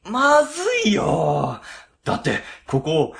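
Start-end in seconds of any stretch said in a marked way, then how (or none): 0.0-1.6 drawn-out scared cry; frightened. Acted. Boss wailing to new hire about the haunted room, scared. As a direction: stretch it out in fright.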